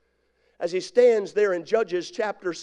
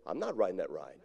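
quiet; 13 semitones lower, 13 dB quieter, faster than usual. Acted. Scared person refuses ride.

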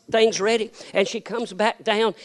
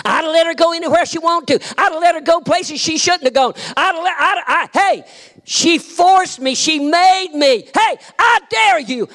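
higher, louder fiery preacher's shout; preaching at full force; 9 semitones higher, 4 dB louder than usual. Real, not acted. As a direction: dare the congregation, full fire.

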